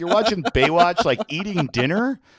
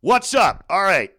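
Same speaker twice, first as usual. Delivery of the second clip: hyped news host; 4 semitones higher than usual. Pretend. Playing hype news host.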